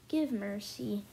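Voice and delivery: ordinary talk, nothing marked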